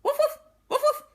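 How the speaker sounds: high, loud, fast barking dog voice. Acted. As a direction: bark the words like a rowdy dog. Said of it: "barked the dog".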